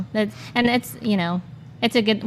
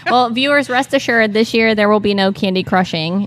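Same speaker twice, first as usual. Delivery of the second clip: announcer voice; 5 dB louder than usual. Acted. Addresses viewers like announcer.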